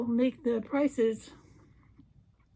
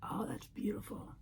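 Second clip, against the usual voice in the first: whispered admiration; 3 semitones lower, 14 dB quieter than usual. Real, not acted. Admiring makeup under breath.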